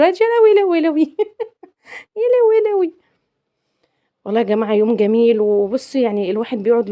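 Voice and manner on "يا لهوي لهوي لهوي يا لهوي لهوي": comic shock. Real, not acted. Shock at arm in video.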